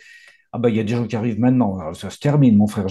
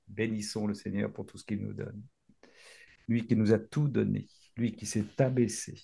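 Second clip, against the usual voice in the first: low, hushed prayer of praise; low murmured devotional prayer; 4 semitones lower than usual. Acted.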